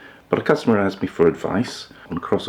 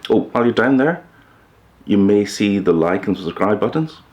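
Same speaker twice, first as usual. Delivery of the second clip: higher, louder playful nudge; cheeky aside; 5 semitones higher, 3 dB louder than usual. Real, not acted. Host nudging viewers toward the subscribe buttons, cheeky and higher. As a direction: slip it in like a wink to the viewer.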